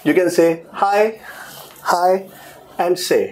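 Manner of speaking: hi, then hi again, showing the sound; pronunciation demo. Shown as a demonstration. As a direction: spell out heiße slowly as hi and se. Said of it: "That's the pronunciation".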